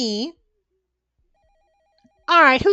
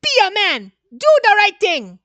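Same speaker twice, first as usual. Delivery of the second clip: loud, high rallying call; heroic exhortation; 7 semitones higher, 5 dB louder than usual. Acted. Urging himself to do the right thing, loud and rousing. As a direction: rouse him like a movie hero.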